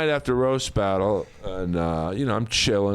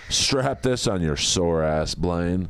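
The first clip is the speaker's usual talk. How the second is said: slow, lower voice of a tough soldier, only lightly put on; Predator movie impression; 6 semitones lower, slower than usual. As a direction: drag the line out like a gruff movie commando. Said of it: "the follow-up line's better".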